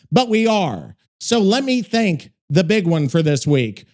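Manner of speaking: usual speaking voice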